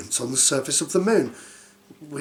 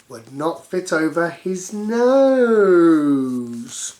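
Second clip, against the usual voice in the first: slow, drawn out punchline; slow comic emphasis; 4 dB louder, much slower than usual. Acted. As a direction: stretch the joke out.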